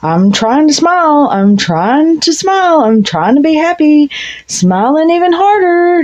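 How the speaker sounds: high, strained voice of someone forcing a smile; forced smile voice. Retold or imitated. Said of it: "She's like".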